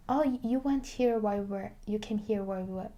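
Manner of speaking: normal talking voice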